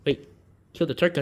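ordinary delivery

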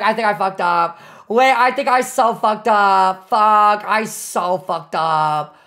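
ordinary talk, nothing marked

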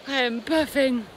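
panting after the climb; breathless, winded; out of breath. Real, not acted.

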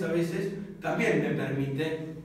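usual speaking voice

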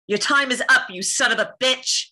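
loud, high defiance; defiant threat. Acted. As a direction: deliver a heroine's showdown threat. Performs requested curse line.

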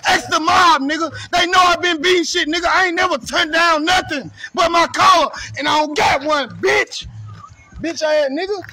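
loud, high shouted boast; furious yelling rant; angry shout. Real, not acted. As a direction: bark every threat at full volume. Heated callout rant, shouting.

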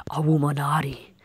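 hushed conspiratorial whisper. Real, not acted. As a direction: breathe it secretly. Whispered joke name.

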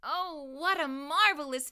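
ordinary delivery

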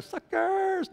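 high-pitched teasing jeer; playful sing-out taunt; mock gloating. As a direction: crow over the losers in jest.

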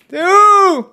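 loud, high-pitched call. Real, not acted. Gamer looking for an unhearing teammate, calling out. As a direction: shout to find someone. Calling to player who cannot hear.

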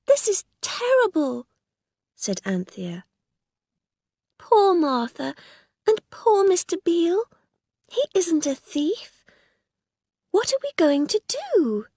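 put-on a girl fretting over poor Martha; distressed girl Anthea.

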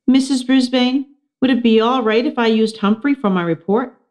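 put-on high, shy girl asking. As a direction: ask timidly, voice lifted. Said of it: "Saya shyly raised her hand".

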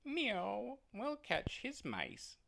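sly, purring meow; meowing cat. Acted. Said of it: "said the cat".

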